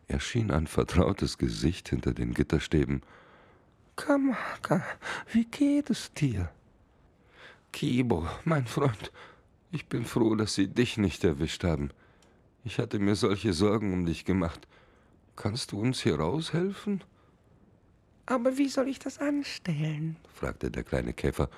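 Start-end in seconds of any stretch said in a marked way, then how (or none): 4.0-6.5 tiny high-pitched beetle calling out; small insect voice, called up the ship. Acted. Beetle greets his friend. 7.7-17.0 lightly higher, relieved prisoner; relieved friend. Acted. Kamaka relieved, behind bars. 18.2-20.2 tiny high-pitched beetle calling out; small insect voice, called up the ship. Acted. Beetle unsure.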